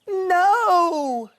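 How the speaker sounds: high, crying no; upset no. A narrator's reading. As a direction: wail in despair. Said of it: "said Douglas".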